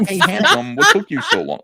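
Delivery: high, loud mock flirt; laughing falsetto imitation of a flirty heroine. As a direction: purr it up high like a cartoon vamp. Voicing Yaira flirt.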